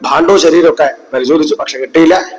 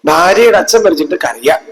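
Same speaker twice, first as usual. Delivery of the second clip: normal talking voice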